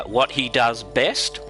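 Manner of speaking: ordinary talk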